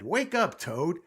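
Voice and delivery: high, loud call; wake-up shout. A narrator's reading. Frog standing on Toad's bed, shouting him awake. Frog rouses sleeping toad.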